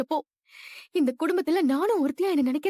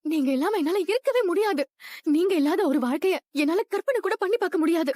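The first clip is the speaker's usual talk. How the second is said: ordinary talk, nothing marked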